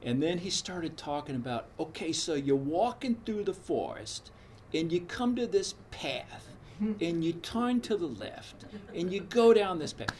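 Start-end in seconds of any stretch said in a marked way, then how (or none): 1.8-6.5 imitating a heavy Brooklyn guided-meditation voice, pitched higher; lightly mock a Brooklyn guy leading a meditation; higher Brooklyn accent done as a retelling. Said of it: "this heavy Brooklyn accent". 6.8-10.1 mock Brooklyn accent, slowed, rising in level. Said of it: "this heavy Brooklyn accent".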